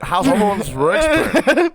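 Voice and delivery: high, drawn-out goofy repeat; silly voice. Pretend. Host repeats his question to the guys, silly high voice.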